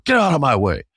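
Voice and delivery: student shoving past his acting coach, furious; angry shove-past line. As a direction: push past him, spitting it out. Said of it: "And I go".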